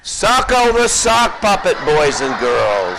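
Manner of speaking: put-on high showy kids' host; excited introduction. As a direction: present to the children. Host introduces puppet to kids.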